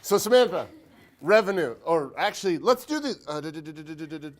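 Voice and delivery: loud, high start tumbling into rapid filler syllables; hyper fast talk. Real, not acted. Hyper teacher calling on a student, talking too fast. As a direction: rattle it off, hyper and tripping over yourself. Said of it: "I am really talking fast".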